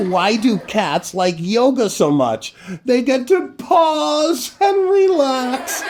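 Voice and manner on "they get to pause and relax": high, slowed, laughing through it; laughing punchline. Real, not acted. Host telling a yoga cat joke, high and slow with laughter. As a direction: savour the pun while giggling.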